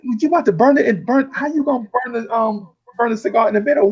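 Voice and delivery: high, bewildered question; confused. Retold or imitated. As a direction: puzzle over this odd method. Confused by the lighting.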